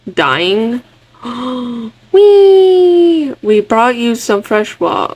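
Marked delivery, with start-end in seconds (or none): 1.2-1.9 short, quiet intake of breath; gasp. Real, not acted. Player reacting to the game, soft gasp. 2.1-3.3 long, high, loud squeal falling at the end; playful whee. Real, not acted. Player playing with friends, high gleeful squeal.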